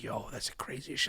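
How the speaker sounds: hushed whisper of amazement; awed whisper. Retold or imitated. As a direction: whisper it to a friend in awe. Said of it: "And I was like".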